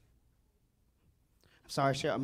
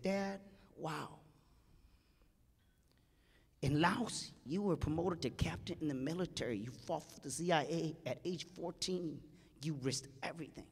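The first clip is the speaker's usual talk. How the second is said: quiet upset plea; 8 dB quieter than usual. Retold or imitated. Son's tearful plea to dad.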